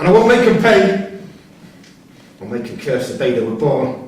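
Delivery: loud, burning revenge; furious vow. Acted. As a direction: threaten them through the rage.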